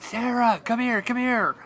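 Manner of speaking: calling across the house. Retold or imitated. Calling daughter into the room.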